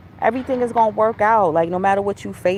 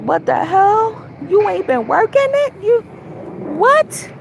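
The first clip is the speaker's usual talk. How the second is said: voicing sore thighs; 12 semitones higher than usual. Her aching thighs scold her after the gym, high and indignant. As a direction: give the thighs an outraged high voice. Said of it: "My thighs is like".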